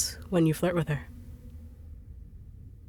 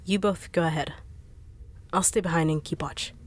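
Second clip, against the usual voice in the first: usual speaking voice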